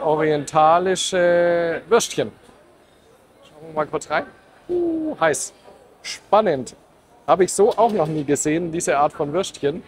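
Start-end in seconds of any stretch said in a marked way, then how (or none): 4.7-5.5 surprised yelp. Real, not acted. Steam from hot food pot.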